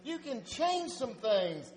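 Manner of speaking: loud, rising pep-talk urging; encouraging Jesus. Acted. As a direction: cheer him on into the dark like a coach.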